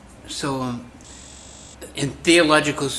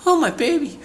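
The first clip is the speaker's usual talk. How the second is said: high, loud cry of a doting mother; 5 dB louder than usual. Retold or imitated. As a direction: gush over the baby like a mother. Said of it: "Suppose a mother sees her baby's shoes".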